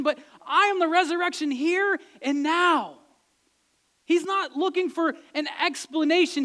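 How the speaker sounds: high, loud, forceful sermon delivery; impassioned preaching. Real, not acted.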